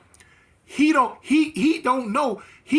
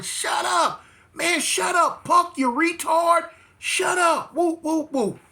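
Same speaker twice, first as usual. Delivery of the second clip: high-pitched mock yelling, no real volume; imitated hollering; 8 semitones higher, slower than usual. Inmates on the tier telling the loudmouth to shut up, imitated hollering. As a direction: mimic fed-up men yelling down the tier. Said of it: "people on the tier hollering".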